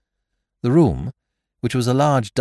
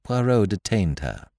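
usual speaking voice

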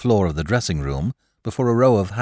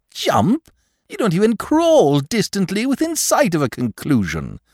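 high, louder mocking scorn; scornful Lord Peter; 14 semitones higher, 4 dB louder than usual. Lord Peter mocking Parker's caution, high and scornful. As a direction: scoff at him, high and loud.

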